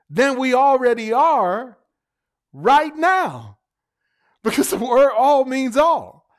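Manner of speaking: high, loud preaching climax; impassioned pulpit preaching; preaching at full force. Real, not acted. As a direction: build the sermon to its peak.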